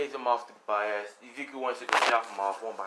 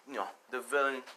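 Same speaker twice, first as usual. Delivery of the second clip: usual speaking voice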